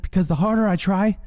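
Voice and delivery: high-pitched mock outburst; acted frustration. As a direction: wail it in exaggerated frustration. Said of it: "I get so angry".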